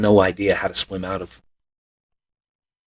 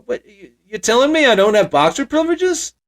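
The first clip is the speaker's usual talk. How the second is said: high, whiny, indignant sitcom complaint; Larry David impression; 11 semitones higher than usual. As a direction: play it like a put-upon comedian. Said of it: "channeling my inner Larry David".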